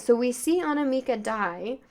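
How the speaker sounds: ordinary talk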